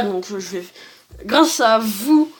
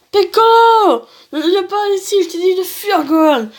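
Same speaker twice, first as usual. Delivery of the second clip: high-pitched dramatic pleading; urgent acted cry; 7 semitones higher than usual. Piccolo and Gohan cry out to each other, urgent acted cry. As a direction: shout a desperate warning in character.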